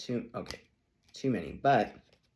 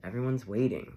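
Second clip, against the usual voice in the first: ordinary talk, nothing marked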